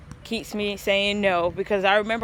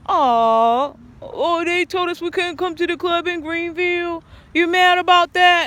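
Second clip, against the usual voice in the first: higher, mocking sing-song tease; taunting; 8 semitones higher, 4 dB louder than usual. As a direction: needle her friend about missing the club. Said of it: "it was like a taunt".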